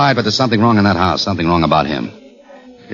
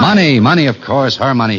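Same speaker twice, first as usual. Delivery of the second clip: excited realization; 7 semitones higher than usual. Acted. Detective answering his partner, suddenly excited. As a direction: seize the idea with sudden energy.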